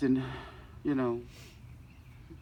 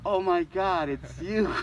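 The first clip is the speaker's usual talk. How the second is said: louder, higher delighted exclamation; surprised recognition; 5 semitones higher, 5 dB louder, slower than usual. Retold or imitated. Turning round to find an old friend in line, surprised. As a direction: retell a sudden burst of recognition.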